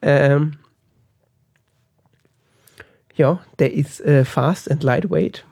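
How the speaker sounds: strained, failing voice, slow; voice giving out. Real, not acted. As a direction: speak as the voice gives out. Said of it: "Deine Stimme versagt".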